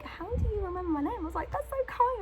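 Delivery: touched surprise. Retold or imitated. Touched by clerk remembering her.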